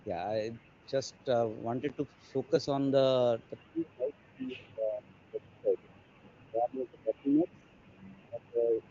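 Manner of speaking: faint and distant, hard to hear; very low voice, almost lost; barely audible. Real, not acted. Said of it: "your voice is very low".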